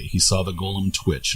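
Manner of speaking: plain reading voice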